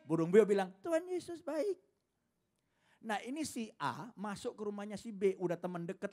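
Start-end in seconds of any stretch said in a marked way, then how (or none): 0.8-1.8 high parrot echo with a rise. Retold or imitated. Parrot copying its owner's praise, high. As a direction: repeat it like a parrot.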